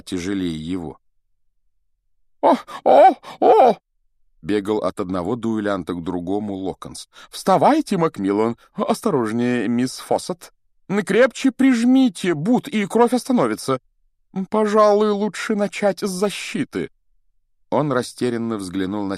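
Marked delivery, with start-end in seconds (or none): 2.4-3.8 high, loud cries of alarm; flustered Lockhart. Acted. Fussy teacher running between duelling pupils, flustered high cries. 7.3-16.9 high-pitched, loud, fussing teacher; flustered Lockhart. Acted. Teacher tending to pupils after the duels, flustered and high.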